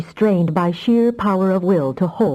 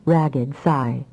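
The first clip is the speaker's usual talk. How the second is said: ordinary delivery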